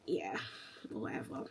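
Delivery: very low, soft whatever; deflated, dropped-down self-quote; dismissive resignation. Retold or imitated. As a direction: give up with a low mumble. Acts out having given up.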